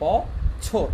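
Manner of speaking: বছর in two separated syllables, bo, chhor; teacher spelling out বছর by ear; careful pronunciation demo. Shown as a demonstration. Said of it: "কিন্তু উচ্চারণটা কিন্তু এভাবে হচ্ছে".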